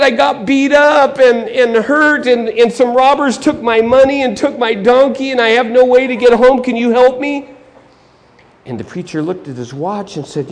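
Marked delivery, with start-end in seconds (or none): 0.0-7.5 high, pained explanation; hammed-up victim asking for help; pleading injured man. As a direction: pour out the troubles and beg.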